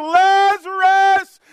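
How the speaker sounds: long drawn-out, high shout; loud cry. Acted. Jesus calling the dead man out of the tomb, loud cry. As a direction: call out at full voice, stretching the name. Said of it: "cries out in a loud voice".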